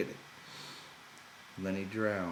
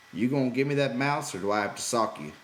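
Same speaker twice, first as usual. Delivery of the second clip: louder, hard cold threat; 5 semitones higher, 7 dB louder, faster than usual. A narrator's reading. George demanding the mouse from Lenny, cold and louder. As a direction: threaten him flatly and firmly. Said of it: "George said coldly".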